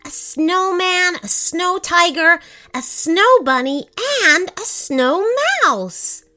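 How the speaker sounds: put-on high, loud excited child; excited listing. A narrator's reading. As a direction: proudly list each one. Boy listing snow friends.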